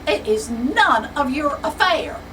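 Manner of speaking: indignant, loud and clipped; offended secretary, loud and livid. Acted. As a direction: snap back in offended fury. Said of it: "Miss Sneed is livid".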